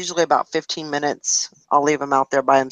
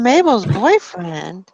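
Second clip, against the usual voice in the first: teasing; 3 semitones higher, much slower than usual. Real, not acted. Teasing question.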